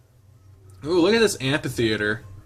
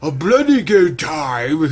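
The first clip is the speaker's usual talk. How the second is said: loud, drawn-out bad British accent; badly faked British accent; 5 semitones higher, 7 dB louder, slower than usual. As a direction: ham up a terrible British accent, higher and slow. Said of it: "Like a bad accent".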